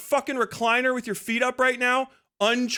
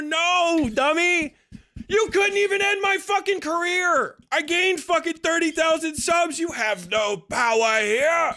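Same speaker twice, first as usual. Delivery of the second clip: taunting shout; 5 semitones higher than usual. Real, not acted. Streamer to his critics, gloating shout. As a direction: crow your victory at them.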